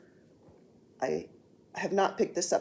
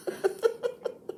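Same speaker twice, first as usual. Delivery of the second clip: high, breath-filled laugh; real soft giggle, pitched up; 4 dB quieter than usual. Real, not acted. Vlogger still amused by husband's interruption, laughing.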